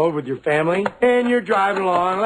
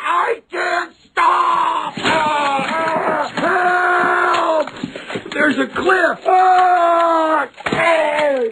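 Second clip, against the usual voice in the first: panicked screaming; 11 semitones higher, much slower than usual. Acted. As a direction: scream as the car goes over. Driver panics as car crashes.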